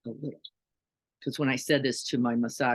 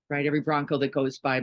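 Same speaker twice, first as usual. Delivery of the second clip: ordinary delivery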